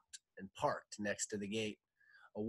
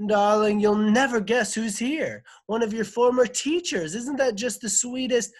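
high, loud, delighted announcement; thrilled husband calling out his news; excited husband; 13 semitones higher, 12 dB louder than usual. A narrator's reading. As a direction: gush with the big surprise for your wife. Husband announces a surprise visitor.

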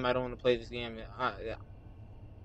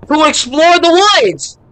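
much higher and louder, slow; terrified shriek; 11 semitones higher, 13 dB louder than usual. Real, not acted. Gamer shrieking as the game sends him into the woods. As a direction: squeal in real terror.

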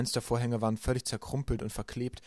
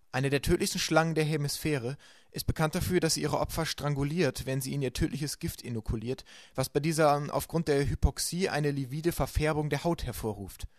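put-on boy reading an encyclopedia, higher, slowing on the hard words; reading aloud from a book; 4 semitones higher than usual. A narrator's reading. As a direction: read the entry out carefully. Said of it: "laut aus einem Buch vorzulesen".

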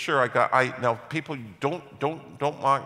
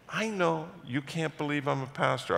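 ordinary delivery

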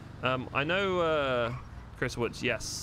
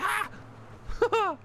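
imitated high-pitched startled scream; mock a panicked yelp, very high; overreacting shriek. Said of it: "overreaction. I was like".

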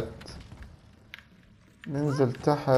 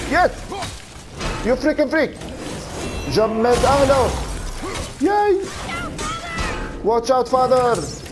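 excited shouting; 5 semitones higher, much slower than usual. Real, not acted. Shouting during the boss fight.

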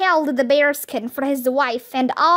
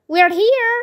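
high, loud call; a girl calling back to her friend; 7 semitones higher, 4 dB louder than usual. A narrator's reading. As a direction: let them hear you from the shed. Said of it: "Annie called".

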